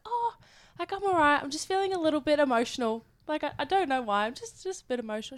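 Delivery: lightly hammed-up weepy reply to a friend; mock emotional whimper. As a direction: play it a little fragile. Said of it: "I'm like".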